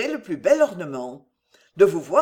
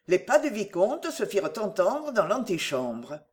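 ordinary delivery

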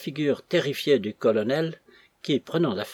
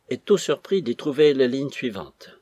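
usual speaking voice

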